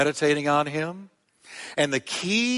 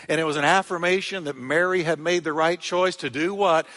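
ordinary talk, nothing marked

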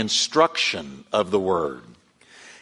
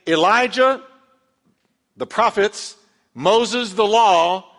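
fervent preaching; 7 semitones higher, 4 dB louder than usual. Acted. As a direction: lift the church up with it. Preaching at full force.

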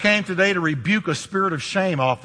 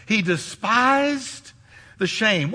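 slow and deliberate; 2 semitones higher, slower than usual. Shown as a demonstration. Preacher slowing down so the church grasps it. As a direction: stretch out despised, let it sink in. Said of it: "What does that mean?".

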